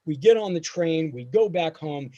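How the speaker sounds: usual speaking voice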